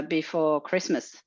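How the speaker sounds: ordinary talk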